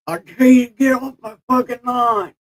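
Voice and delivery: high, loud cranky geezer voice; mock get-off-my-lawn grouch; grumpy old man. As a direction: play a toothless old crank yelling at kids. Imagined reply to chat.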